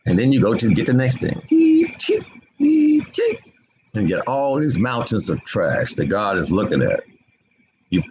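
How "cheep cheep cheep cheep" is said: squeaky little imitated chirps; high-pitched cheeping noise. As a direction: make high, thin cheeps.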